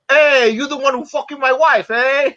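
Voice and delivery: put-on high, punchy Cuban-accented challenge. [name] confronting the new studio boss, high Ricky Ricardo Cuban accent. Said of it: "with that Ricky Ricardo accent".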